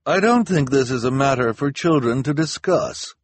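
high, loud official dismissal; pompous officer addressing a crowd; officious policewoman. A narrator's reading. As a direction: shut the children out with loud authority. Officer publicly dismisses the children.

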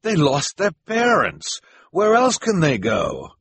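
high, loud plea; crying out. A narrator's reading. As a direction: plead slowly, pitched high. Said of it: "Jerome cried".

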